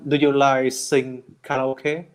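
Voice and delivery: usual speaking voice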